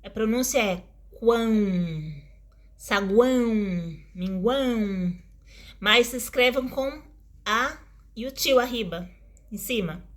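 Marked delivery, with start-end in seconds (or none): 1.2-5.1 "quão", "saguão", "minguão" separately, nasal endings held; nasal triphthong. Shown as a demonstration. Teacher showing how the word is pronounced, nasal triphthong. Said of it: "cuja pronúncia do quão é".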